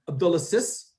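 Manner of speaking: "Abdulaziz" with a short hissed "-sis" ending; name ending in short -sis. Shown as a demonstration. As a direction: say "Abdulaziz" as if it ended like "sister". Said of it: "do you say".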